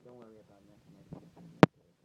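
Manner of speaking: whispered comfort; soft, breath-only reassurance; hushed reassurance. Retold or imitated. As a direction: soothe a little cousin, almost without voice. Comforting the cousin after hug.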